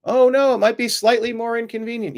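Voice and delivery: high-pitched sarcastic whine; mock dismay. Mocks an objection to voter ID.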